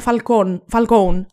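Falcone said "fal-KOHN", final vowel dropped; Falcone as in English. Retold or imitated. Host quoting what sounded strange, Falcone as "fal-kown". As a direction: repeat Falcone ending on "-kown". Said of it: "με ξένησε όταν είπανε".

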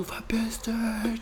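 very high, drawn-out mock scream; stretched, high-pitched dismissal; shouting teacher. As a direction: imitate a squeaky teacher screeching someone out. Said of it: "Der schreit".

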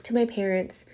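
ordinary delivery

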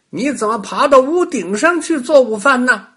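put-on high, loud childlike rabbit; bunny voice. A narrator's reading. Little rabbit asks the climbing witch, high and innocent.

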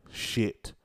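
usual speaking voice

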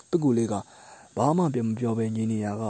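usual speaking voice

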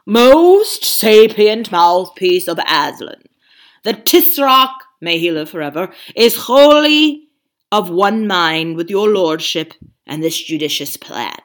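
pompous Calormen. Acted. Calormen bowing and answering the ape pompously. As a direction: puff up each flattering word. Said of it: "replied in the pompous Calormen way".

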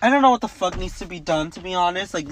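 normal talking voice

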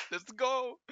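grito agudo de celebración. Real, not acted. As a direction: grita eufórico de emoción. (¡Let's go! gritado muy agudo)

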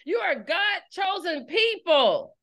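slow, shouted proclamation; proclaimed. Acted. Preacher affirming her listeners, slow shout.

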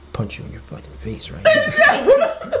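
high, slow mock shout with a laugh; hammed-up Snape screaming at the boys; mock threat shout. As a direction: yell the threat, cracking up. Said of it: "He's like".

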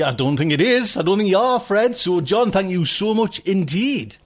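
usual speaking voice

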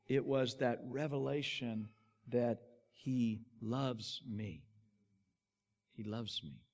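low, soft, slow and heartfelt; hushed sincerity. Real, not acted. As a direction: let it sink almost to a murmur. Hushed point of the sermon.

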